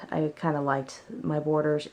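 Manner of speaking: normal talking voice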